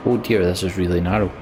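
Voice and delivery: ordinary delivery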